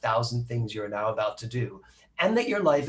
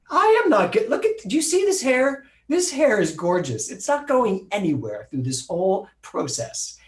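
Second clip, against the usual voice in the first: much higher and louder, outraged vanity; indignant refusal; 9 semitones higher, 5 dB louder than usual. Retold or imitated. Telling doctors she won't lose her hair, indignant and loud. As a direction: protest like a diva defending her hair. Retold refusal to shave head.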